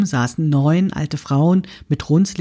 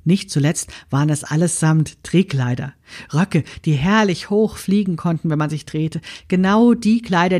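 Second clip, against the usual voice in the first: usual speaking voice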